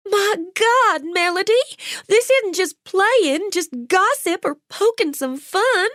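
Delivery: high, frantic and tearful; Jolene, near tears, defies Melody; distraught defiance. A narrator's reading. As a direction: refuse to be silenced, voice up high.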